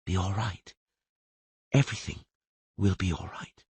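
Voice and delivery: calm a dying man gently; gentle soothing voice. Acted. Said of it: "a quiet, gentle, soothing voice".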